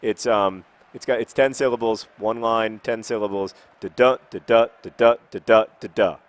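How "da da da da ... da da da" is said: "da-da" five times, second DA punched louder; iambic pentameter rhythm. Shown as a demonstration. Teaching viewers iambic pentameter, "da-DA" stressed in even pairs. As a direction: beat out "da-da" with stress on each second syllable. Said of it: "That's how it sounds".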